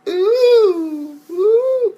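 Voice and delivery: very high, loud, slow drawn-out ooh; hammed-up amazement; mock awed ooh. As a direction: swoon like a fan at the big moment. Imitating hype moments in episode.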